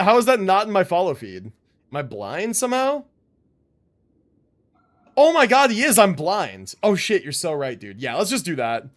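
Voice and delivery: usual speaking voice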